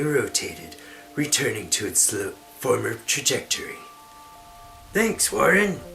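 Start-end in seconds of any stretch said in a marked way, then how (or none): 4.9-5.8 hammy stage actor. Acted. Amira voicing her line.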